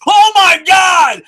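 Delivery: high, loud and slow "oh my god"; real fan's shout of joy; excited shout. Real, not acted. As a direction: stretch it out in thrilled disbelief. Reacting to surprise wrestling spot.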